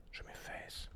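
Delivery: tense whisper while aiming. Real, not acted. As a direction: breathe it out, no voice. Same words whispered while aiming.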